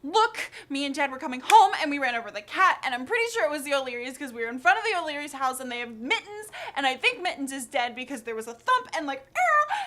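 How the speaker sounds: loud, high, fast blurted confession; panicked kid spilling everything at once; word vomit. Acted. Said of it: "word vomit. I tell her, like".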